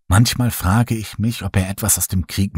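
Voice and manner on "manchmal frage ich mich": ordinary delivery, nothing marked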